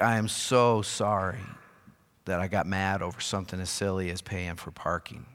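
contrite apology. Retold or imitated. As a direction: apologise humbly, voice sinking. Retells his apology to his wife.

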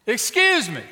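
high, loud, emphatic interruption; insistent call. Acted. Preacher repeats himself to ignoring mother, insistent and loud. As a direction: call out hard to be noticed. Repeats it to get her attention.

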